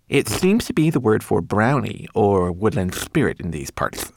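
Twigleg-style careful interpreting; precise little interpreter. Acted. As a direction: explain it as a fussy tiny translator.

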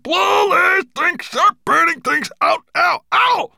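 high, loud howl of pain and fury; agonized dragon shouting orders; bellowing. A narrator's reading. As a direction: roar through the pain. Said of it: "he bellowed".

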